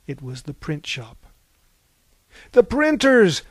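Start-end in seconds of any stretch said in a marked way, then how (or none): 2.5-3.4 loud, high cry of alarm; alarmed scream. A narrator's reading. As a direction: cry out in narrated panic. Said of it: "screamed R T".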